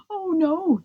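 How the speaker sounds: high dismayed oh no; gently alarmed father; surprised. A narrator's reading.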